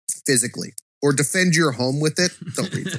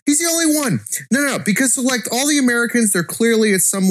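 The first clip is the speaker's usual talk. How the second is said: excited; 9 semitones higher than usual. Real, not acted. Excited defense of the character.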